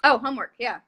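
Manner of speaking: usual speaking voice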